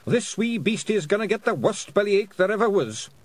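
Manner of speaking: high, defiant Scots character voice; Rob Anybody. A narrator's reading. Pictsie with drawn sword facing whale, high Scots threat.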